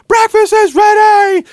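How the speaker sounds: high, loud shout down the stairs; wife yelling. Retold or imitated. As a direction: holler up high like a wife calling everyone to eat. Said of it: "yelling down there".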